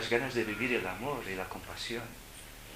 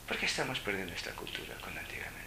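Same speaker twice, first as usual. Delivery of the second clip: whispered question; 5 semitones lower, 7 dB quieter than usual. Real, not acted. Sad rhetorical question.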